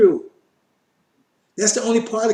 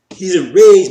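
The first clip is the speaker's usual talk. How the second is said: forceful emphasis; 9 dB louder, slower than usual. Real, not acted. Preacher stressing the verse.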